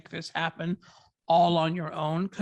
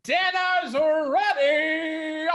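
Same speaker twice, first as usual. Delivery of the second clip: slow, drawn-out call across the house, much higher; 9 semitones higher, much slower than usual. Acted. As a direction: shout that dinner is ready from the kitchen. Calling her to dinner.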